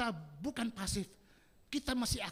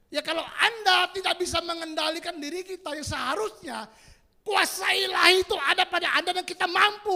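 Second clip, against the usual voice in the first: loud, raised preaching voice; full-force preaching; 6 semitones higher, 12 dB louder than usual. Real, not acted. Preacher warning believers about the enemy's attack, full-force preaching. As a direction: drive it home to the congregation, loudly.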